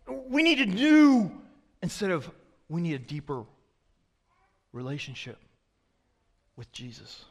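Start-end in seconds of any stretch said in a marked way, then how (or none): 0.1-1.3 high, slow push on "new"; eager craving. Retold or imitated. Churchgoers craving a new experience, high and eager. Contrasting wrong craving with right one. 2.7-7.2 low, soft, with long pauses; hushed sincerity. Retold or imitated. Preacher offering the better wish, hushed and sincere. Contrasting wrong craving with right one.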